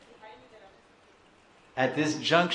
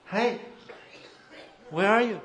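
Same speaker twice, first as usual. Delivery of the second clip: high-pitched searching call; 8 semitones higher than usual. Acted. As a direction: call out high for someone who has vanished. Devotee calls for vanished Krishna.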